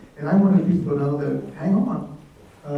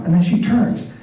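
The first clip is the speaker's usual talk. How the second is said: ordinary talk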